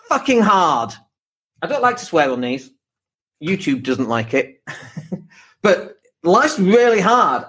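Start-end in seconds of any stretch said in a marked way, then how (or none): 5.6-7.4 whiny voice. Pretend. Echoes the whiny voice.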